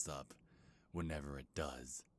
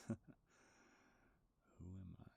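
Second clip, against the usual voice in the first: soft breathy chuckle; 2 semitones higher, 14 dB quieter, faster than usual. Acted. King mocks the listener's question.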